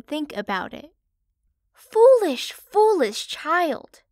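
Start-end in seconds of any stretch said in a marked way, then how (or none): 1.7-4.0 much higher, louder scolding cry; exasperated mother. Acted. Mother pig scolding Parlo, loud exasperated cry. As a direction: throw up your hands at a silly child. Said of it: "said the mother pig".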